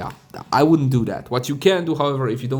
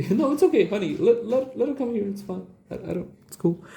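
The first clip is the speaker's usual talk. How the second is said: soothing aside to loved ones; 8 semitones higher than usual. Real, not acted. Soothing wife and baby mid-video.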